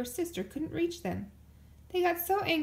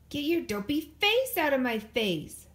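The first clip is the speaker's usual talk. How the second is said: loud angry snarl, higher; 5 semitones higher, 6 dB louder than usual. A narrator's reading. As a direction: spit it out in anger. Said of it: "snarled Brother".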